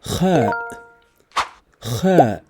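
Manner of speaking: Kha scraped from the back of the throat like Spanish g; throaty Kha. Shown as a demonstration. Teacher demonstrating the throat sound to learners, scraped. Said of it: "comes from the back of the throat".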